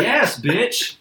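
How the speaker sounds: high, loud mock fury; fed-up outburst. Customer asked the order three times, loudly fed up. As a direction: snap it back at the order-taker.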